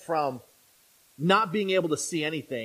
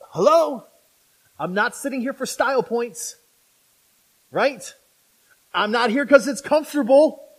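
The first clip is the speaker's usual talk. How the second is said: indignant sarcasm; 5 semitones higher, 6 dB louder than usual. Retold or imitated. Lame man's indignant sarcastic retort.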